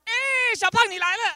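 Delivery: loud, very high-pitched holler; barber's greeting shout. Retold or imitated. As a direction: shout a big hearty hello across the shop. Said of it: "He'd look at me and go".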